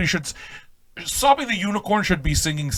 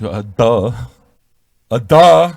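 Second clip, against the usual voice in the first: mock duh; 4 semitones lower, 8 dB louder, much slower than usual. Mocking the wife's stoner remark.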